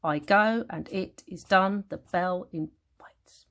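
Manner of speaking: "I go and it is done" scanned slowly, weak then strong; testing iambic beat. Shown as a demonstration. Teacher checking for iambs, "I go and it is done" slowly scanned. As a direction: beat out "The bell invites" slowly, syllable by syllable. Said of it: "that isn't iambic there".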